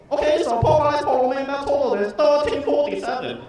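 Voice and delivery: put-on high, loud cashier in accent; brisk Chinese-accented order taker.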